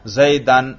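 زیدن said "Zaydan", with an a; accusative ending. Shown as a demonstration. Teacher showing the class audible case endings, زیدن as "Zaydan". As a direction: end زیدن in "-an". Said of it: "میں نے نصب پڑھا".

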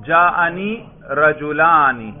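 رجلانی slowly, with a long audible "aa"; pronounced alif. Shown as a demonstration. Teacher showing the alif is actually pronounced, pronounced alif. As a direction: draw out rajulaa-ni in رجلانی. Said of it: "الف پر ہم باقاعدہ تلفظ کر رہے".

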